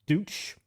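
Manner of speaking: "Deutsch" said as "dootch", rising as a question; unsure pronunciation. Shown as a demonstration. Streamer unsure how to pronounce it, "Deutsch" as a questioning "dootch". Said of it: "don't know how to pronounce it".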